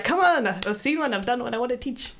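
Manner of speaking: high, exasperated "come on" plea; retold frustration. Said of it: "It's so frustrating. I'm like".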